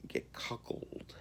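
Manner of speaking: slow whispered aside; hushed aside. Real, not acted. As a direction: drop to a whisper for the naughty word. Hushed aside on taboo word.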